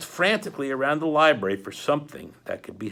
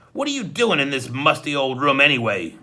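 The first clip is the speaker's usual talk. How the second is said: lightly voiced croaking hook-handed man; a hint of a croak, menacing; croaky henchman; 2 semitones higher, 8 dB louder than usual. A narrator's reading. Hook-handed man croaks at Klaus in the library, lightly menacing. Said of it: "in his croak of a voice".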